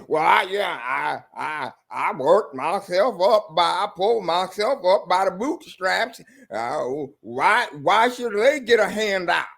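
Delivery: lightly put-on Southern accent with a mock shout; a weak imitation of a Southern accent; bad Southern accent. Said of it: "horrible Southern accent".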